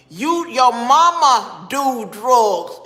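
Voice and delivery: "mama do drugs" drawn out slow and loud; slow right down and punch each word of "your mama do drugs"; stressed, deliberate comeback on "your mama do drugs"; emphatic retort. Real, not acted. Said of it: "and emphasize that".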